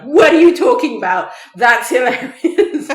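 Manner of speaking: loud, high yell of disbelief; high-pitched, incredulous shouting; indignant yell. Retold or imitated. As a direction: yell it in outrage at the radio. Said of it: "I, like, yelled into my car radio".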